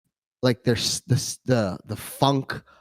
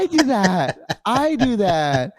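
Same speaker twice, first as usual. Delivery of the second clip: mock confession; 7 semitones higher, slower than usual. Joking along about the habit.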